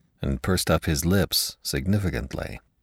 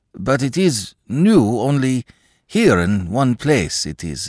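high, halting, hesitant clerk; timid stammering clerk; 5 semitones higher than usual. Acted. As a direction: fumble the words, unsure. Akaky voiced high, halting.

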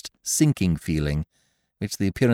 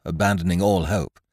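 ordinary delivery

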